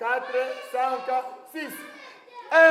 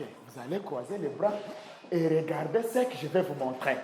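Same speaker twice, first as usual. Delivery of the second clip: much lower, soft settling voice; hushed instruction; 9 semitones lower, 9 dB quieter than usual. Real, not acted. Teacher to class with arms to cross, hushed. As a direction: bring the class down quietly.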